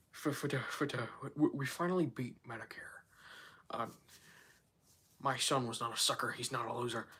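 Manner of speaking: put-on halting, soft, low old politician's mumble; Joe Biden. Streamer testing a debate impression on chat, quiet halting Biden. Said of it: "I could do a good Joe Biden".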